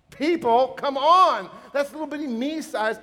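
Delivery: high, loud appeal to the crowd; preacher rousing the room: people, come on, loud and high; loud exhortation. Real, not acted. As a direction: call the congregation out, loud and high. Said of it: "preacher exhorting the congregation".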